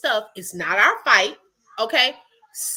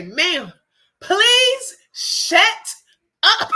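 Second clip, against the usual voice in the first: louder, higher snap at the lady; blurted retort; 5 semitones higher, 5 dB louder, much slower than usual. Retold or imitated. Her urge to silence the meddling lady, a louder, higher outburst. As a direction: let the held-back retort burst out, louder and higher. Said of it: "I felt it rolling off my tongue".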